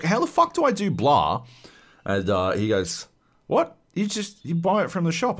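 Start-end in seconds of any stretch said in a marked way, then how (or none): 0.0-1.4 high, frustrated plea for help; exasperated question. Retold or imitated. Stuck gamer asking his brother again, high and exasperated. 3.5-5.4 very high, disbelieving "What?"; mock the brother's disbelief at the obvious; incredulous brother. Brother answering a clueless question, high and incredulous.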